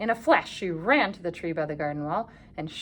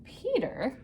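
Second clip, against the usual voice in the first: ordinary delivery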